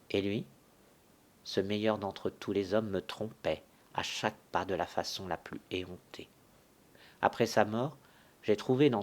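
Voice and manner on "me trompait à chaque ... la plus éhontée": bitter whisper. Acted. Widow confides husband's betrayal.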